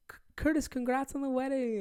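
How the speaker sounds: high, chirpy fan congratulating; mock eager fan. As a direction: squeak it out like an excited fan. Mimicking fans greeting them.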